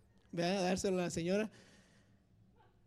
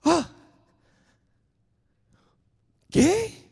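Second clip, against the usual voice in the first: loud, much higher astonished cry; amazed; 6 semitones higher, 11 dB louder, slower than usual. Retold or imitated. Finding zero wrong answers, a loud amazed cry. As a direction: burst out in disbelief. Passing the exam.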